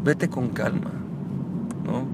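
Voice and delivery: soft, soothing brush-off to an angry wife; mock serene calm. Shown as a demonstration. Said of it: "pasa igual con tu esposa".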